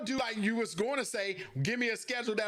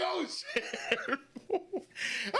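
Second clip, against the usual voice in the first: laughing; 9 semitones higher, much slower than usual. Retold or imitated. Embarrassed realization in retold story.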